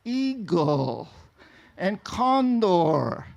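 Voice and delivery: "Eagle and condor" spoken very slowly; very slow English. Retold or imitated. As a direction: draw each word out for a foreigner. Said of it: "in very slow English".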